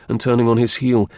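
ordinary delivery

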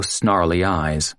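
plain reading voice

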